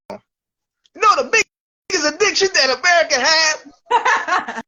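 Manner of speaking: high-pitched, punchy stand-up delivery; Chris Rock impression. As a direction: hammer out the question, then land the punchline. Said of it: "I'm gonna do an imitation".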